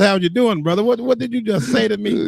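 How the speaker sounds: high, loud mock indignation; incredulous. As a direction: squeak out offended disbelief. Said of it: "I just asked".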